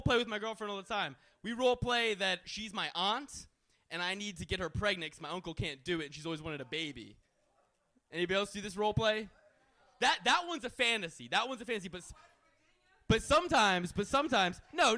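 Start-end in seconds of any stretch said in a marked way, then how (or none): none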